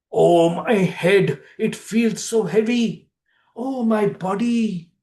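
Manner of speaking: slow, drowsy groaning; slow groggy moan; groggy waking. Acted.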